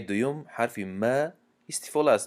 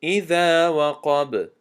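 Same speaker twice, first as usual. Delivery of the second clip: slow melodic tajweed chant, drawn out; Quran recitation; 6 semitones higher, 5 dB louder, much slower than usual. Shown as a demonstration. Teacher recites al-Falaq to students in slow tajweed chant. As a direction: recite each verse slowly, measured and melodic.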